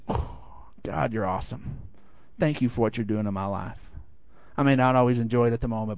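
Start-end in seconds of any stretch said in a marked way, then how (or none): 0.1-3.9 low, soft, near-whispered awe; quiet relieved surrender to God; hushed grateful prayer. Acted. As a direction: drop to an intimate, thankful murmur.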